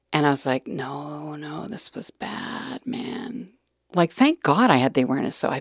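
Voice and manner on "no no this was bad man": slow, soft near-whisper; hushed realisation. Retold or imitated. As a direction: murmur it slowly to yourself, shaken. Inner realisation retold.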